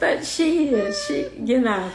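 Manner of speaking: choked-up, laughing through tears; tearful. Real, not acted. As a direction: struggle to finish through tears. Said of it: "got me crying now".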